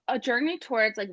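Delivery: ordinary talk, nothing marked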